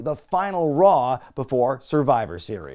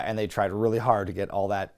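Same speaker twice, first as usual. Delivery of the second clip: ordinary delivery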